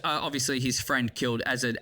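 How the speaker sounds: ordinary talk, nothing marked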